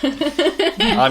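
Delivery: laughing while speaking fast. Real, not acted. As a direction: talk through laughter. Laughing at the joke.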